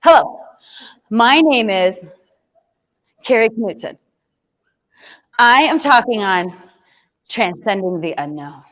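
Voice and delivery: halting, stiff put-on presenter voice; weird presenter voice. First video, a nervous speaker reads her name and title in an odd put-on voice. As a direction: read the intro haltingly, like a nervous robot. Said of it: "talking in the weirdest voice ever".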